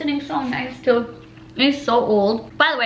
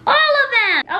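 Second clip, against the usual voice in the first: high, loud delight; excited exclaim; 12 semitones higher, 4 dB louder, slower than usual. Real, not acted. Owner thrilled the dog can eat everything, excited. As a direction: burst out happily. Fruits safe for dogs.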